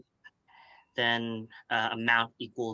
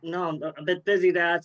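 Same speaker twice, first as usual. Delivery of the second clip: calling out; 7 semitones higher, 6 dB louder than usual. Real, not acted. Calling back to dad mid-meeting.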